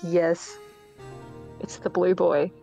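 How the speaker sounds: ordinary delivery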